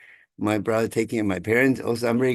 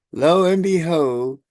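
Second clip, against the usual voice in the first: slow, drawn out, higher and louder; dramatic reveal; 7 semitones higher, 6 dB louder, much slower than usual. Real, not acted. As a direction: draw it out like a big reveal. Dramatic turn in family story.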